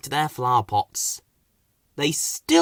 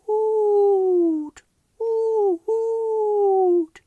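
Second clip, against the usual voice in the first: high, long owl hoots; very high falling owl hoots; much slower than usual. Acted. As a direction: hoot like an owl in the dark. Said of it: "an owl hooted".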